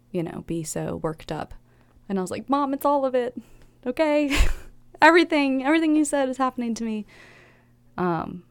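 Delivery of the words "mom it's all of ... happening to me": much higher, laughing exasperation; retold outburst to Mom, high and laughing; exasperated outburst. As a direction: blurt it all out, half-laughing.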